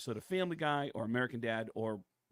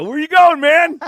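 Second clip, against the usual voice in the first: high, loud excited call after them; overexcited shout; 23 dB louder, slower than usual. Retold or imitated. Eager fan shouting after a departing celebrity, high and loud. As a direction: yell it like a thrilled fan who won't let them go.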